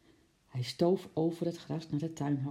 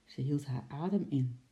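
ordinary delivery

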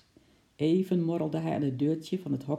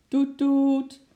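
high, loud blaring toots; car horn imitation; 10 semitones higher, 7 dB louder than usual. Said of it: "De klakson scheurde door de stille laan".

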